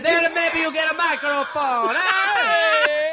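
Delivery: hammed-up Italian club boss; mock Italian accent. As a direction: lay the cartoon Italian accent on thick. Improvises as the accented booker.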